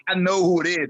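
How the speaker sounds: high-pitched mimicry of an accusing wrestler; mock-accusing wrestler impression; Dream. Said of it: "He goes".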